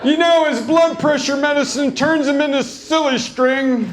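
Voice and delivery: high, put-on mom voice; hammed-up mom zinging dad; mom's one-liners. Mom mocking dad to the waitresses, in a high put-on voice. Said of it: "spouting off one-liners".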